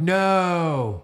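long drawn-out "no"; mock group dismay. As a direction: groan out a collective no in mock protest. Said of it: "we're all like".

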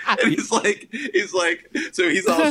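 talking through real laughter; high-pitched, amused retelling while laughing. Real, not acted. Laughing at his own anecdote.

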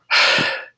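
long, heavy acted sigh; weary sigh. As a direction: sigh like a worn-out official. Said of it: "the guy sighs".

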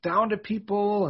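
put-on high whiny little kid; upset kid. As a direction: complain like an upset child. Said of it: "and I'd be like".

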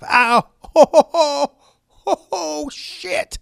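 high yelp of real pain, breaking into hoots; sudden pain. Real, not acted. Podcaster just squished his nuts, yelping in pain. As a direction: howl high and loud, just hurt. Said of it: "I just squished my nuts".

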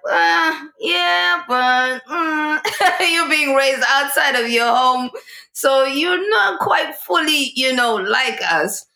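high-pitched, hesitant hedging; reluctant judgement. Retold or imitated. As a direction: imitate people politely excluding someone. Voicing people's hesitant judgement.

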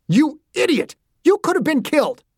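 high, strained scolding yell; angry outburst. A narrator's reading. Angry father to daughter who nearly died, furious scolding. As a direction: burst out at the reckless child. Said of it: "He was too angry".

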